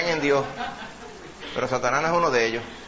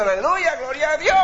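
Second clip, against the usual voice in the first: much higher and louder cry of praise; praise shout; 12 semitones higher, 3 dB louder than usual. Retold or imitated. Preacher imitating a worshipper praising nonstop, loud cry. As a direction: call it out to the rafters. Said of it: "tanto va usted estar diciendo".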